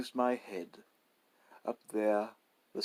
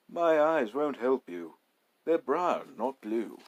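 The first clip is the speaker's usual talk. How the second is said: louder, higher insistence; desperate protest; 4 semitones higher, 6 dB louder than usual. Acted. Narrator protesting to the robber, desperate. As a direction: plead the point urgently.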